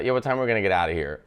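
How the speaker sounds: quick, slangy and run together; casual fast version. Shown as a demonstration. Talking to a foreign tour guide, fast and casual. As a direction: rattle it off like chatting with a friend. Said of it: "I wouldn't say".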